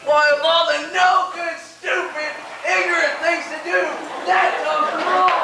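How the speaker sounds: high, loud tirade; furious shout. Acted. Husband hearing the pork chops burned, furious outburst. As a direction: rant in outrage, dragging out the last words.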